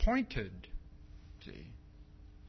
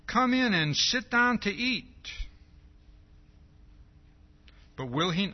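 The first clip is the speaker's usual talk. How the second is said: raised, earnest reading of scripture; preacher reading Luke aloud; 4 dB louder than usual. Acted. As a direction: read it out to the congregation with force.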